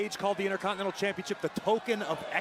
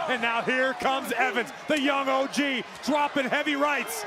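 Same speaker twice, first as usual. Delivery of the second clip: excited commentator; 6 semitones higher than usual. Real, not acted. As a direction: hype up the crowd at home. Co-commentator hyped by action.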